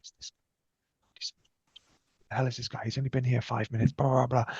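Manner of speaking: hushed mock gossip; retold whispering. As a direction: mutter it like cadets sniping behind his back. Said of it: "I could hear people whispering".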